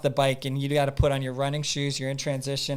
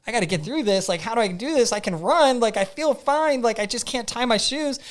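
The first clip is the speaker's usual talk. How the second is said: higher-pitched, mildly panicked retelling of his own thoughts; flustered self-talk; 7 semitones higher than usual. Retold or imitated. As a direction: retell your rising fluster, pitched well up. Retold panic at tying shoes.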